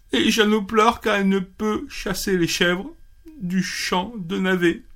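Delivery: a small fox whimpering out his reason; high sad fox voice. Acted. Fox explaining to the rabbit, high and mildly tearful.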